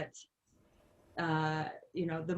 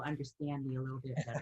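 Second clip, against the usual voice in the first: put-on quick, lower Minnesotan accent; 4 semitones lower, 4 dB quieter, faster than usual. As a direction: slip into broad Minnesota speech. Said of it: "if I just slip into it now and then".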